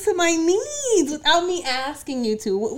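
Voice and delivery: high, loud, stretched-out praise; over-the-top admiring gush; gushing amazement. Acted. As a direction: marvel at someone's devotion, drawing it out. Gushing over mother's extra care.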